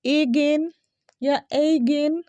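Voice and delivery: again drawn out with an "ay" start; wrong pronunciation. Shown as a demonstration. Teacher showing daily mistakes, wrong pronunciation. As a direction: say again slowly as AY-gain. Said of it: "बहुत सिली सा mistake".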